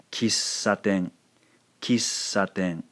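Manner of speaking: "Kissaten" with the s held an extra beat; slow "Kissaten" with a continuous long s; elongated S. Shown as a demonstration. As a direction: hover on the ss of "Kissaten" before the a, slowly. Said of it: "sort of elongating the sound".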